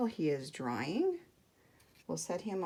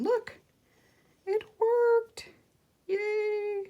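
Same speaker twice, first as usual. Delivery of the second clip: high, delighted squeal of success; thrilled little victory cry; excited cheer; 5 dB louder, much slower than usual. Real, not acted. Seal works again after trouble.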